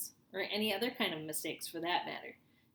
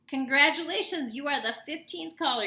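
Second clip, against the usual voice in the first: higher, louder hyped announcement; excited DJ; 8 semitones higher, 11 dB louder than usual. A narrator's reading. Radio DJ hyping up the lucky caller, excited. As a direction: sell it like a radio host.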